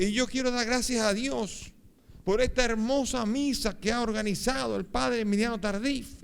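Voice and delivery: high, slow proclamation of thanks; ringing public thanksgiving, pitched high; exultant testimony. Retold or imitated. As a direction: imitate a man testifying to a crowd. Healed man continues thanking crowd.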